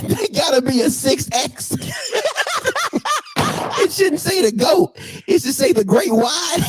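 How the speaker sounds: high voice breaking into laughter; real, high-pitched laughing mockery; laughing roast. Real, not acted. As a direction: crack up between the jabs. Cracking up while roasting the photo.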